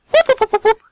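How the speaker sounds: very high, loud whooping; silly whoops. Pretend. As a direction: whoop like a goofy siren. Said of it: "I doubt they whooped as they walked away".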